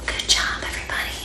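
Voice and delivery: soft whispered praise. Real, not acted. Librarian whispering praise to children. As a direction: hush it like a secret.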